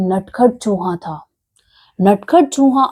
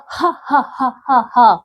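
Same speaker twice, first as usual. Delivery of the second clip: mocking laugh; 2 semitones higher than usual. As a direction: laugh the little mouse off. Shopkeeper mocks the mouse. (हँसी उड़ाते हुए धीमी हँसी)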